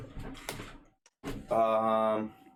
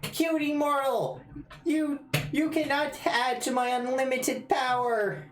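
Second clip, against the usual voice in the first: tiny villain voice. Acted. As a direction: squeak out grand threats like a tiny overlord.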